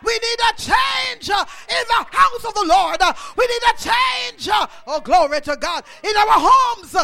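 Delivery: loud, rising preacher's shout over the congregation; preaching at full force. Acted. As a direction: thunder it out like a revival preacher.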